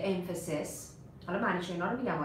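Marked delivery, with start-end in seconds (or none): 0.0-0.7 emphasis ending in a short "-sis"; singular ending. Shown as a demonstration. Teacher giving the class the singular form, emphasis with short final vowel. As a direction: say emphasis as "EM-fuh-sis", softly. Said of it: "این سینگولارشه".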